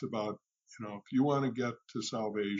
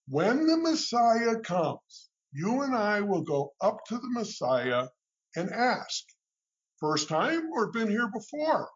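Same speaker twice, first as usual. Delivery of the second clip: high-pitched storytelling imitation, easing down at the end; raised, deliberate mimicking voice, settling toward the close; Yitzhak Rabin; 7 semitones higher than usual. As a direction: imitate a statesman making a pronouncement, pitched well up. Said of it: "Yitzhak had that kind of voice".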